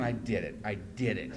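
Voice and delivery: low, soft self-congratulation; hushed acted triumph; private relief. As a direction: say it quietly to yourself. Private relief after first share.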